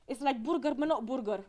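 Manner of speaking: imitated Romanian English with rolled r in burger; Romanian accent. Tourist imitating a guide describing local food, Romanian accent. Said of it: "let me do the accent".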